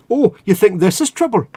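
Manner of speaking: a failed stab at a tough Geordie; attempted Geordie accent. Speaker voicing the fish as a Geordie, failed Geordie accent. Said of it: "I can't do a Geordie accent".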